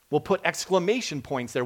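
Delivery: ordinary delivery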